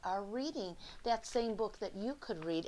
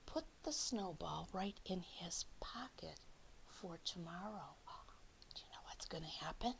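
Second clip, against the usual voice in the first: whisper; 2 semitones lower, 12 dB quieter than usual. Acted. Secret snowball for tomorrow.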